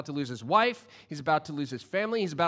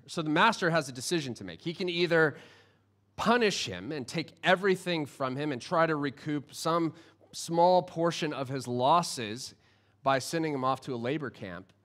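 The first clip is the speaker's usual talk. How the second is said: normal talking voice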